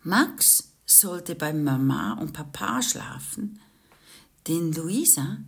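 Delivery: usual speaking voice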